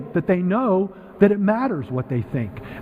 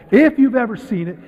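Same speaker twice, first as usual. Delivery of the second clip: raised, louder emphasis on If; stress on IF; 5 semitones higher, 4 dB louder than usual. Real, not acted. Speaker repeats the condition to audience, stress on IF. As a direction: punch the opening If. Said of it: "and I say if".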